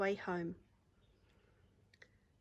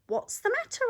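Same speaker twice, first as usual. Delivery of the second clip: much higher, louder caring question; kind George asking; 5 dB louder than usual. Acted. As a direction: ask gently but high and bright. George asks the howling dog.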